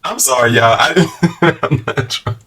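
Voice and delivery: breaking into laughter mid-sentence; laughing. Real, not acted. Vlogger apologizing to viewers, laughing. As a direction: giggle helplessly through the words.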